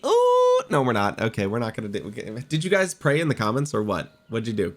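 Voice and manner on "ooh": long, very high rising "ooh"; high suspenseful ooh. Real, not acted. Player watching for the eight spot, high hopeful ooh. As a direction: squeal an ooh as the wheel slows. Hoping the spin hits eight.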